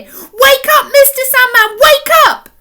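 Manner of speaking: put-on loud, high yelling child; girl shouting in the Sandman's ear; bellowing. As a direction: bellow it right into his ear. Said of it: "bellowed in his ear".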